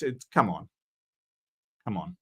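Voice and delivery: ordinary talk, nothing marked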